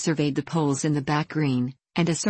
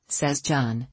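ordinary delivery, nothing marked

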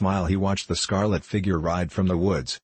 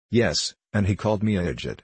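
ordinary delivery

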